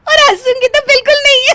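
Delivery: fast, giggling promise; wife laughing as she promises not to laugh. Acted. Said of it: "तुम तो फिर से हंस दी".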